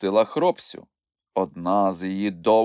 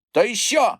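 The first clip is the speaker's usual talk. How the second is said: high, louder cross retort; unrelenting; 14 semitones higher, 5 dB louder than usual. A narrator's reading. Moomintroll keeps quarrelling with Chmykh, defiant. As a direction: fire back defiantly. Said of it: "не вгавав Мумітроль".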